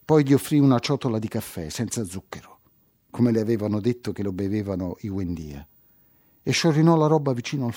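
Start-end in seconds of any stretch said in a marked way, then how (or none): none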